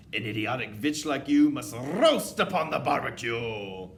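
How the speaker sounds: menacing witch verdict; terrible recital. A narrator's reading. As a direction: proclaim the punishment with relish and force. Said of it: "continued with her terrible recital".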